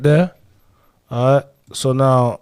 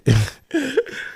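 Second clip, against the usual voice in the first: high burst of laughter; 10 semitones higher than usual. Real, not acted. Cracking up at the clip.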